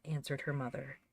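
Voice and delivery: ordinary delivery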